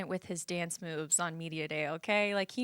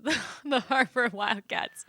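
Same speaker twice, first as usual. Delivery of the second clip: laughing; 4 semitones higher, 4 dB louder than usual. Real, not acted. Laughing over her joke pick.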